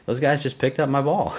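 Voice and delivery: calling out high across the fairway; retold yell. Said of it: "I'm yelling to him across the fairway".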